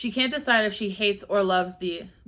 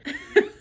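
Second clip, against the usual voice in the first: burst of laughter; 5 dB louder than usual. Real, not acted. Laughing at review typo.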